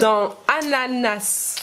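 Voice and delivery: ananas said slowly, syllables spaced; slow teaching voice on ananas; slow, drawn-out ananas. Shown as a demonstration. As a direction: stretch ananas out for the child. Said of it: "tu entends le son A où".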